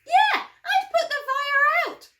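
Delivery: loud laughing exclamation, high; laughing shout. Acted. Teacher joking to kids about the burning bush, laughing shout. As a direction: blurt it out with a laugh.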